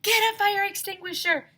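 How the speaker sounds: high, loud urgent yell; frantic yell. A narrator's reading. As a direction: shout the order. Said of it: "somebody yelled".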